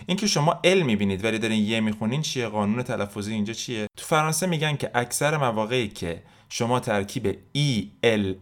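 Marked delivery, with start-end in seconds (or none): none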